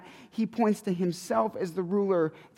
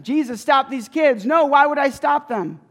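higher, louder demand and retort; dramatic exchange; 6 semitones higher, 7 dB louder than usual. Retold or imitated. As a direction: fire the demand, then fire it back. Voicing priests and Jesus.

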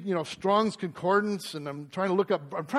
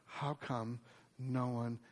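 tearful question; 7 semitones lower, 15 dB quieter than usual. Retold or imitated. Preacher voicing his crying employee Melissa, softly tearful. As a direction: let it come out small and choked up. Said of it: "she starts crying".

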